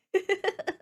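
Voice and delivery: high little real laugh. Real, not acted. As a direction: giggle after your own dark joke. Laughs after dark joke.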